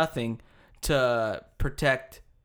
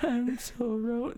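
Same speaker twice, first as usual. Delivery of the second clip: slow, soft puppet voice saying ro for lo; laugh, then drawl it like the lonely puppet; Kim Jong-il puppet voice; 9 semitones higher, 5 dB quieter, slower than usual. Retold or imitated. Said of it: "that was Kim Jong-il".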